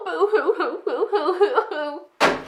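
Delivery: high, wailing fake sobs; hammed-up boo-hooing; mock crying. [name] jeers at Mama's self-pity, mock crying.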